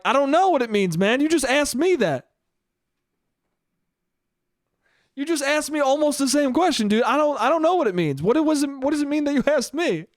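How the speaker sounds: hammed-up flustered comeback; mock exasperation. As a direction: throw the question back, indignant and high. Acted exasperated comeback in imagined exchange.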